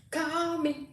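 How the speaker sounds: high, soft, drawn-out call; sing-song plea. Real, not acted. Host urging a friend to phone her, sing-song. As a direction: stretch CALL out long and high. Repeats it, stretched out for emphasis.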